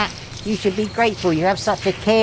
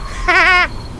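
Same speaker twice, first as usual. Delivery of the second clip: mocking laugh; 11 semitones higher, 3 dB louder than usual. Mocks friends running for lives.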